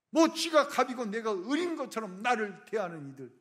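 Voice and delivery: normal talking voice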